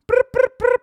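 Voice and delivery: high, loud brr-brr of a police radio; mock emergency radio trill; imitated radio alarm. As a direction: chirp it out like an alarm going off.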